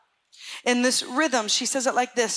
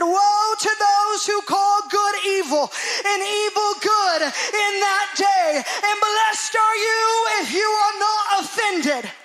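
slow, loud, high proclaiming shout over music; voice of the Lord; 9 semitones higher, 5 dB louder, slower than usual. Acted. The Lord answering the dreamer, slow booming proclamation. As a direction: thunder the Lord's words slowly. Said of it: "and he said".

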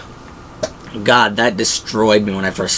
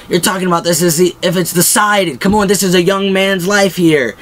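higher, pressing mock protest; acted urgent plea; 7 semitones higher than usual. Dale argues for a young man's life, acted urgent plea. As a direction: imitate an exasperated plea, pitched up.

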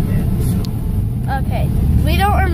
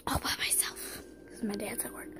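whispering; 5 semitones lower, 18 dB quieter than usual. Real, not acted. Whispering alone at home.

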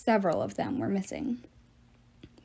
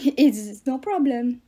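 put-on slow, louder French accent; fake French accent; 2 semitones higher, 5 dB louder, slower than usual. A narrator's reading. Love god shrugs off Piper's insult, slow French accent. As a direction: brush it off slowly in bad French-accented English. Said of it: "he had a French accent so bad".

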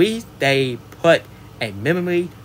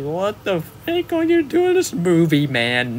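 high-pitched, incredulous outburst; exasperated disbelief; 5 semitones higher than usual. Real, not acted. Reviewer baffled by Keon Wee's role, pitched-up exasperation. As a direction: squeak out your dismay at the casting. Reviewer dismayed at actor's casting.

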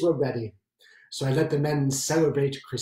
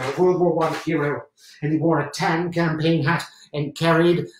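normal talking voice

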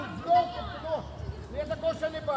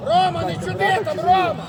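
loud, raised-pitch yell across the pitch; scolding shout; 3 semitones higher, 8 dB louder than usual. Real, not acted. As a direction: yell sharply at a player to behave. Coach scolds player across the pitch.

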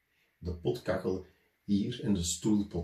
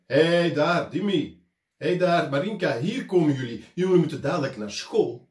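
loud, higher shouting across the dunes; parents calling out; 5 semitones higher, 6 dB louder than usual. A narrator's reading. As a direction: call the children back home. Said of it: "begonnen ze te roepen". (ouders roepen hun kinderen luid en hoger)

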